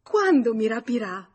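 loud, high, emphatic question on QUANDO; excited, demanding insistence; insistent repeat. A narrator's reading. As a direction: press eagerly, hitting “Quando” high and loud. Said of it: "Ripeté".

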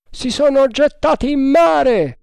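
high, loud alarmed call; shouted report. A narrator's reading. Officer rushing on deck reports escape, high shout. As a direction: cry it out across the deck.

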